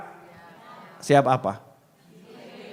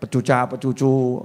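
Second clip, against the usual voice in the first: low, soft pouting mumble; a sulky wife's pouting mumble; mock sulky wife; 5 semitones lower, 4 dB quieter than usual. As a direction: pout it out like a sulking wife. Mocking a sulky wife again.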